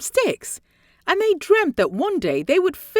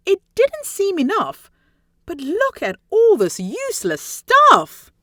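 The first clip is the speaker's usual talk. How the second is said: crying out in dismay; 6 semitones higher than usual. Acted.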